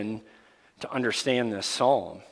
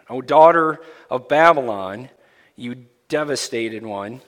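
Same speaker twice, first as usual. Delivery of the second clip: louder, solemn Bible reading from the pulpit; scripture reading; 2 semitones higher, 3 dB louder than usual. Acted. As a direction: proclaim the psalm to the congregation. Reads scripture aloud.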